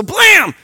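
sudden high shouted blam; sharp shouted sound effect; loud blam. Acted. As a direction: slam the sound out like a door. Covering eyes and hands.

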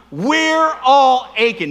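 very slow, loud, aching stretched out; call and response. Shown as a demonstration. Preacher leads the congregation's repeat of the refrain, very slow and loud. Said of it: "One more time".

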